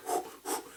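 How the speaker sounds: unvoiced breathy puffs; hushed nervous blowing; nervous breaths. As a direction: catch your breath behind cover. Breathing while hiding.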